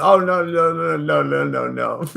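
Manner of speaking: high, loud mocking protest, falling away; mocking objectors. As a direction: squeal a string of no's in a put-on voice. Mocking retold panel's objection.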